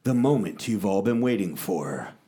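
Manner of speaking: big booming host announcement, drawn out; announcer intro. Pretend. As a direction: announce it like a ringmaster.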